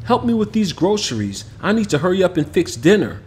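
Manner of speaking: a mom in a hurry to cook; mother asking her son for help with the groceries, brisk; busy mom. Acted. Narrator voices mother.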